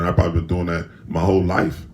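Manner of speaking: ordinary talk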